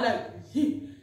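breaks into a laugh mid-word; laughing at how absurd it was. Real, not acted. Telling viewers the story, a real laugh.

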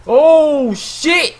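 high, loud drawn-out "oh"; stretched high cry of alarm; startled yell. Real, not acted. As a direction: exclaim in shock, voice leaping up. Startled in game.

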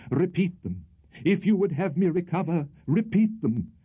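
high, urgent entreaty; ardent pleading. A narrator's reading. Tupman to Rachel for her words, pleading. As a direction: beg with the pitch held high.

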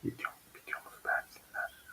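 feed him the line in a whisper. Real, not acted. Prompting the son on camera.

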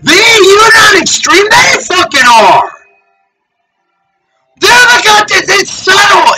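high, shouting accusation; high, raging pulpit shout; furious shout. Real, not acted. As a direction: hurl it at them in a shout.

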